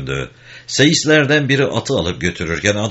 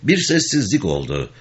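usual speaking voice

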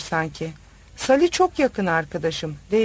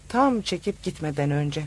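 ordinary delivery, nothing marked

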